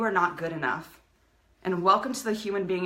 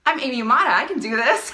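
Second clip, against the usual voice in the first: loud, higher, laughing self-assertion; powerful declaration; 5 semitones higher, 8 dB louder than usual. Real, not acted. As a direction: claim your power out loud. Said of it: "I get into my power, into my voice".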